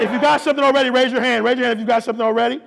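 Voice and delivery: high, loud crowd hype; rousing preacher's call. Real, not acted. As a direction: shout questions to energize the congregation. Works up the crowd.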